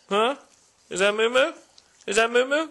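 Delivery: bright questioning voice to the dog; pet talk. Real, not acted. Owner greets his dog Moo Moo, cooing pet talk. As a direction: coax the dog with a rising question.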